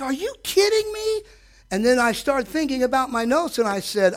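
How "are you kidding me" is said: much higher, incredulous; incredulous cry. Retold or imitated. Preacher retells reacting to the enemy, incredulous. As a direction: ask it in disbelief. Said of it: "It's like".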